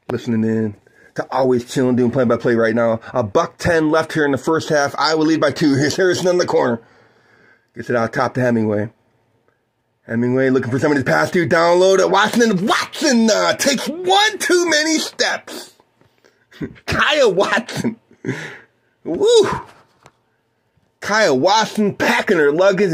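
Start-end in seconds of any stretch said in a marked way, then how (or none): 3.1-12.5 play-by-play. Acted. Calls the game for listeners. 12.5-18.0 excited call. Acted. Calling Watson's travel to listeners, excited high call. 19.0-19.5 high excited whoop. Real, not acted. Cheering the turnover for his listeners, high woo.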